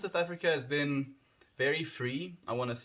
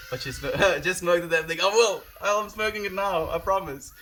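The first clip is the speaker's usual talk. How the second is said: laughing; 6 semitones higher, 7 dB louder than usual. Real, not acted. Laughing at viewer's teasing.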